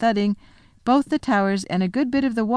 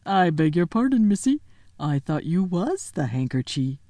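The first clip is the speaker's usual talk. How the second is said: muttering giant. Acted. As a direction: fumble an apology, flustered.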